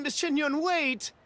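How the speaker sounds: high urgent call to wait; calling after her. Acted. Merchant calls after Shenyun, high and urgent. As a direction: call out before she leaves.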